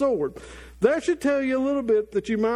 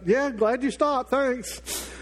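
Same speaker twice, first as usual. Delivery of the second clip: sarcastic thanks; 3 semitones higher, much slower than usual. Retold or imitated. Ironic reply to passing friend.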